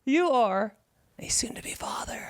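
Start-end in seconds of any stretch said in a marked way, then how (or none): 1.2-2.2 dramatic whispered reveal; hushed whisper. Acted. Host revealing the friend's baby news, hushed whisper. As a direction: whisper it like a big announcement.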